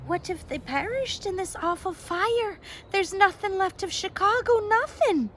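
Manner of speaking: high, rising tearful despair; girl sobbing that Chicago is gone; sobbing harder. A narrator's reading. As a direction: break down over the lost city. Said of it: "Eilish sobbed even harder".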